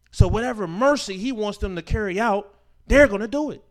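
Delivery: loud, rising preacher shout; preacher building to a shout; preaching climax. Acted. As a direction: drive it home to the crowd.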